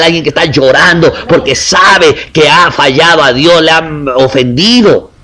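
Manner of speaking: impassioned preaching. Acted. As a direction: preach it to the congregation with rising fervor. Building sermon passage on brokenness.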